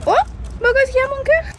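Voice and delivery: high cooing voice to a pet; sweet pet talk. Real, not acted. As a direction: coo at the dog lovingly. Talking to her dog on walk.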